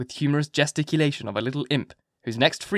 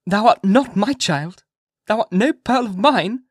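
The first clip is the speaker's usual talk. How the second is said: high, loud, slow mock disowning; playfully emphatic, drawn out; half playful; 9 semitones higher, 6 dB louder, slower than usual. A narrator's reading. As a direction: disown the child half in play. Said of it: "half playfully".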